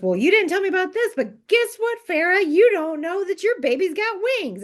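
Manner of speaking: high, fast taunting voice; mock spiteful Nesta. Nesta taunting Feyre, high and fast. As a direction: taunt her as Nesta, hammed-up. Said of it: "Nesta goes".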